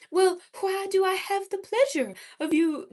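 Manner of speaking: high-pitched mid-Atlantic, laughter creeping in; mid-Atlantic accent. Shown as a demonstration. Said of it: "What should I say in a mid-Atlantic accent?".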